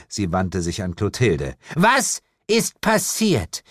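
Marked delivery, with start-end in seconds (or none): 1.8-3.6 slow, high, word-by-word demand; stern interrogation. A narrator's reading. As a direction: demand an answer, drawing out each word. Lilith interrogates the witch. (streng und langsam nachgefragt)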